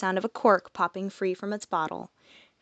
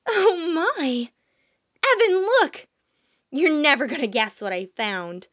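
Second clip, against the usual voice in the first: much higher, louder, bubbling with delight; jovial; 8 semitones higher, 4 dB louder than usual. Acted. Malia calling Evan over to the pixie, jovially excited. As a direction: gush like you found treasure. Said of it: "I heard an impossibly jovial voice".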